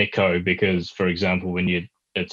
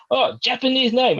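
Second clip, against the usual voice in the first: much higher, surprised; a touch of a surprised clerk; mock surprised local. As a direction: play the local spotting a Japanese name. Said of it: "they'll be like".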